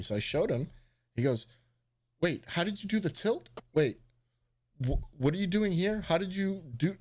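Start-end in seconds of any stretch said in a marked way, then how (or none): 2.2-7.0 dumbfounded. Retold or imitated. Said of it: "he's, like, dumbfounded".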